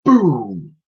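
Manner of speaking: a big booming thunder sound. Acted. Narrator voicing the thunder over the tower, booming. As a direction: boom it out like thunder.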